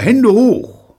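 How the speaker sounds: loud, high hold-up shout; sharp high yell; shouted command. Acted. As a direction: call it out like an ambush. Imagined surprise command, called out.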